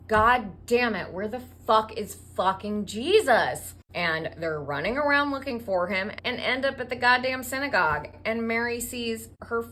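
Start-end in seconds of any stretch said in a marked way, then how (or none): 0.1-3.8 loud, hammed-up parental panic; punchy, exasperated outburst; mock exasperated yell. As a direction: curse loudly as a parent missing a child. Parents realize child is missing.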